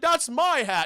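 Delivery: indignant retold complaint; protesting Santa. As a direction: act out an affronted owner. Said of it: "He's like".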